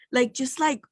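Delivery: ordinary talk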